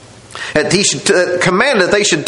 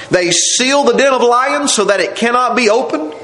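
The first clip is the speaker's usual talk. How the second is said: usual speaking voice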